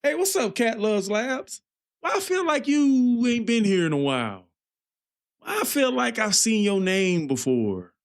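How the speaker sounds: high, bubbly streamer greeting to a donor; high hyped greeting. Retold or imitated. Streamer greeting a returning donor in a high hyped voice. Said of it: "I'll be like".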